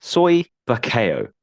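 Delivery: "Soy Buckeo" said slowly, Soy high and drawn out; slow, deliberate "Soy Buckeo", falling at the end; careful pronunciation. Shown as a demonstration. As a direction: sound out "Soy Buckeo" as an unfamiliar name. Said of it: "say it how I think it's pronounced".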